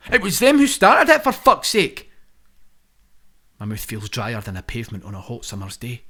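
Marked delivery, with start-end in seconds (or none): 0.1-2.1 loud, high furious rant; angry outburst. Acted. Boris ranting about his critics, loud and furious. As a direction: spit it out in a fury.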